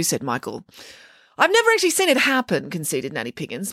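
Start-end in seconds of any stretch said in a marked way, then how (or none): 1.4-2.7 quick high protest. Acted. Nanny Piggins concedes quickly.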